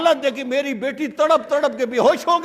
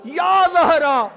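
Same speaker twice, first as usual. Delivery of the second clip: drawn-out calling; much slower than usual. Retold or imitated. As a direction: call her name slowly, each cry stretched. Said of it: "اپنی توجہ کے ساتھ آواز دے".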